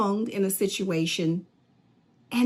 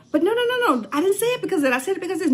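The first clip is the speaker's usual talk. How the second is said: high, fast mock protest; hammed-up pleading, pitched way up; exasperated defender; 11 semitones higher, much faster than usual. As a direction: hurry to defend yourself. Acted argument, defending voice.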